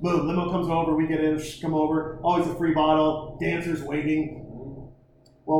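usual speaking voice

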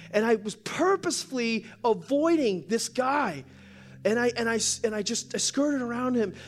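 high, loud, overwrought confession; emotional woman. Retold or imitated. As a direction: pour it out high-pitched, imitating a distraught woman. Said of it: "she's real emotional".

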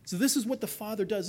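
usual speaking voice

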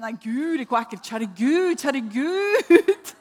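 loud, high, half-laughing plea; loud, high pleading prayer, laughing a little; frightened prayer. Retold or imitated. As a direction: beg God, scared yet laughing at herself. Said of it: "Så tenkte jeg".

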